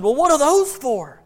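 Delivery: put-on high, loud, drawn-out child's question; acted little-boy voice. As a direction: ask like a curious kid, played big. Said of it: "the little boy said".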